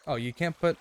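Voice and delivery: ordinary talk, nothing marked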